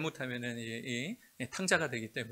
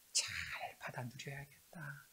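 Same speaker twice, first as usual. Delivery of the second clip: whisper; 10 dB quieter than usual. Retold or imitated. Quiet private resolve in whisper.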